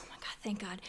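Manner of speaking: soft, whispered thanks; hushed relief. Acted. Mom hugging son home from lockdown, hushed relief. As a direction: breathe it out into a tight hug.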